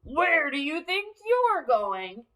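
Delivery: gruff troll challenge. Acted. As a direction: growl it like a troll. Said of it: "the troll yelled".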